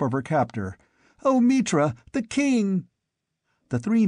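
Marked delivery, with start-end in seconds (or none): 1.2-2.9 high, frantic cry to Mitra; shrieking. A narrator's reading. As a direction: cry out in shocked relief. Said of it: "shrieked Albiona".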